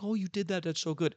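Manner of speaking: high, gushing fake praise; lightly mocking admirer voice. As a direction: imitate someone fawning over him. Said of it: "He doesn't need anyone to be like".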